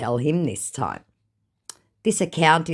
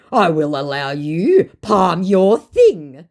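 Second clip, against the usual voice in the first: slow, louder put-on scammer voice; imitating the scammer; 4 semitones higher, 7 dB louder, slower than usual. Angry scammer's message read back, slow mock voice. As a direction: drag out his broken English mockingly.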